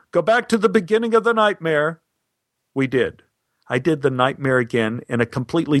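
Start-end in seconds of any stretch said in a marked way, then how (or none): 0.1-2.0 high-pitched mock yell; retold shout without extra volume. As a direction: call an instruction up to the booth. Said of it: "He yelled up to the control booth".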